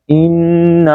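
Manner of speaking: "انا" with held n and long final aa; lengthened ending. Shown as a demonstration. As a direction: draw out both the nasal and the end of "انا". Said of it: "یہاں پہ لمبا نہیں کریں گے".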